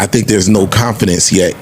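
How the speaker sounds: usual speaking voice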